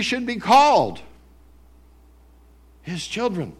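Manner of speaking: loud, slow, driving sermon delivery; booming pulpit emphasis; full-force preaching. Real, not acted. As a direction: preach it out to the room.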